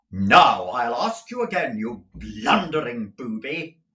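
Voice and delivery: loud, stern threat. A narrator's reading. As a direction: lay it down coldly. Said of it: "very sternly".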